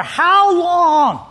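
high, stretched-out plea; acted anxious desperation. Stuck person wondering about their life, anxious and upset. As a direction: cry it out as an imagined panicked thought. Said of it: "anxious and upset and angry and".

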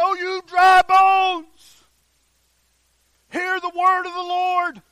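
loud, high, drawn-out proclamation; ringing prophetic call; preaching to oneself. Acted. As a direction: proclaim it forcefully, stretching the words. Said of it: "that's what I say to myself in the mirror".

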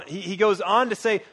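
ordinary delivery

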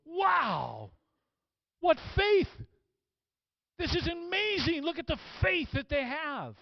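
high-pitched, awestruck outburst; amazed exclamation. Acted. Jesus marveling at someone's great faith, amazed.

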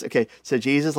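usual speaking voice

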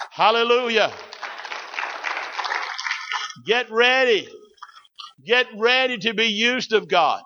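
high, ringing preacher's call; rousing exhortation. Real, not acted. Preacher readying the church to be used of God, rousing exhortation. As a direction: lift the voice and rally the congregation.

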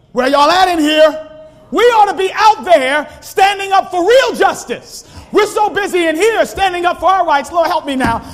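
high, loud pulpit shout; shouting preacher, pressing hard; preaching at full force. Acted. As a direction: call the church out with fire.

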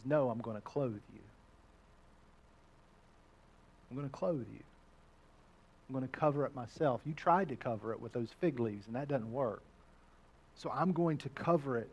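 low, soft, tender; God's reassurance. Acted. God comforting Adam and Eve, low and tender. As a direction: reassure them softly and low. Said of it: "And God says".

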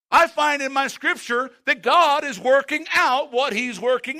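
loud, rising sermon delivery, pressing each line home; preaching at full force. Acted. Pastor urging the congregation, preaching loud and high.

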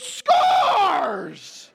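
put-on high, loud triumphant kid shout; excited toddler cheer. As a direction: yell it like a toddler who just scored. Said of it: "he's all excited".

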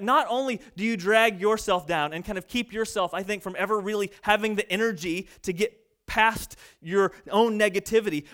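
ordinary delivery

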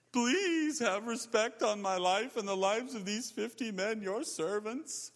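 begging. Acted. Third captain begs Elijah to spare his men, begging. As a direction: plead humbly for your life, hammed up. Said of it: "he begged".

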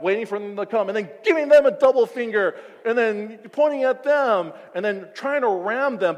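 loud, mocking angry driver rant; hammed-up road rage rant. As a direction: act out the furious driver, hammed-up.

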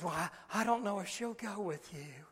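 very soft, halting doubt; hushed hesitant reply. Retold or imitated. Mother unsure the child will go, hushed hesitant reply. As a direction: answer under your breath, unsure. Mother answering quietly during the service.

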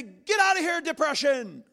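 high, loud mock order to depression; mock command. Preacher to congregation, mock-yelling at depression. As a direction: yell it away like a bossy preacher. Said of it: "I can't say".

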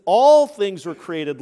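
"All" hit long and very loud; stress on All. Real, not acted. Preacher quoting scripture to his congregation, "All" heavily stressed. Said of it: "I was emphasizing that word".

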